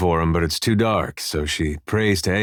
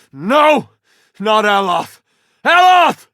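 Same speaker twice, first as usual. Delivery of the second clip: high, loud cry of despair; anguished shout; 13 semitones higher, 8 dB louder, slower than usual. Acted. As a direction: yell for a fallen friend. Crying out as companion falls.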